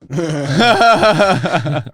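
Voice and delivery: loose tipsy cackle. Real, not acted. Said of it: "I'm a little tipsy".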